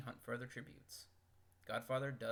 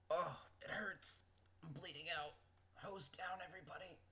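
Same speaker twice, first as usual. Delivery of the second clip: mock dying; 6 semitones higher, 3 dB quieter than usual. Mock dying after knife to chest.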